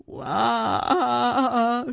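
high, loud, drawn-out bawling; mock crying wail. A narrator's reading. Taya blaming her father, mock crying wail. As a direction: bawl like an upset little kid. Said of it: "She started to cry".

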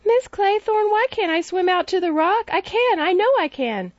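put-on high, pleading child's voice; a small boy begging his governess to let him swim; little boy. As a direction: whine like an eager little boy. Child Cyril voiced in story.

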